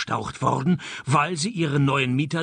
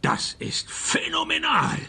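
far higher, hammed-up wonder; mock amazement; 13 semitones higher than usual. The old detective marvels at the internet in mock awe. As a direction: gush with exaggerated amazement.

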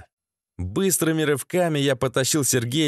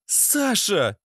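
lightly voiced higher female call; woman calling out; 8 semitones higher than usual. Lena calls to Sasha, higher-pitched voice.

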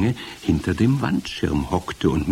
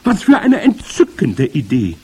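high, loud gleeful witch; thrilled witch follower; screeching delight; 8 semitones higher, 6 dB louder than usual. A narrator's reading. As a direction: squeal with delight at the scheme. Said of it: "kreischten die Hexen".

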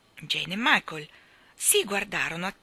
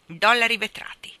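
loud, raised, pompous announcement; self-important; 4 semitones higher, 8 dB louder than usual. A narrator's reading. Hake presents his coins to children, self-important. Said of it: "spiegò assumendo un'aria di importanza".

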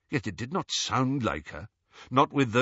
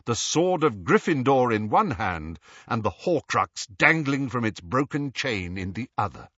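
ordinary delivery, nothing marked

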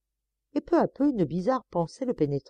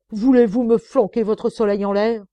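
higher, loud contemptuous yell; angry shout; 4 semitones higher, 9 dB louder than usual. Acted. Sergeant ordering him to toss his mirror away, loud. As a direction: bellow the threat at him.

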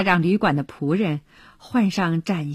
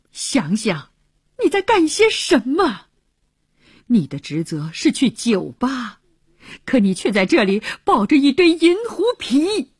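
indignant scolding, flaring up higher on the accusations; angry rebuke; 5 semitones higher than usual. Acted. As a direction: dress down a negligent comrade, pitch leaping in outrage. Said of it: "气愤地说".

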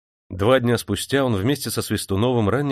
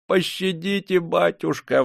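put-on much higher begging old clerk; pleading clerk; 9 semitones higher, slower than usual. A narrator's reading. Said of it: "взмолился Филатка". (умоляющий дьячок)

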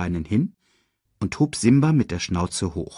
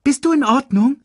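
high, loud meerkat question; concerned meerkat; 7 dB louder than usual. Acted. Meerkat checking the revived cub, high anxious question. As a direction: ask it piping and urgent.

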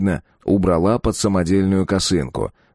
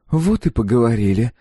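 put-on higher girl's voice with a sigh; a rebuffed girl's sigh, lightly acted; 4 semitones higher than usual. A narrator's reading. Girl to the man who sends her off, sighing. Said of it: "вздохнула та".

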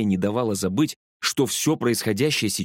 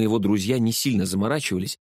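ordinary delivery

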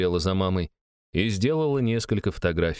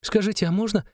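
put-on high pleading child voice; little girl voice; 12 semitones higher than usual. A narrator's reading. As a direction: ask sweetly like a shy kid. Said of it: "отсмеялась Шестакова".

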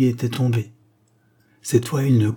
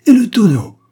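much higher and louder, a sudden shriek; old woman screaming about the lost barrel, much higher and louder; shrill outcry; 12 semitones higher, 11 dB louder than usual. A narrator's reading. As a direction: shriek it at the boy in outrage. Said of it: "s'écria-t-elle".

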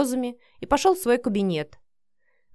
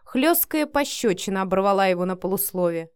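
plain reading voice

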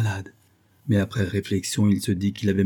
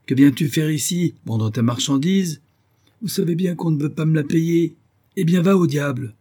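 irritated rebuff; 7 semitones higher, 4 dB louder than usual. Acted. Benzouf rebuffs the peddler.

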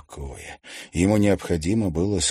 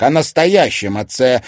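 loud, higher emphasis on "настоящем"; pointed clarification; 6 semitones higher, 10 dB louder than usual. A narrator's reading. Son clarifying to his mother, pointed and loud. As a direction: stress "настоящем" loudly to correct the point. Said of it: "уточнил он".

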